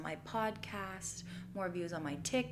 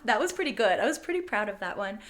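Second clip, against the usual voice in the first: ordinary talk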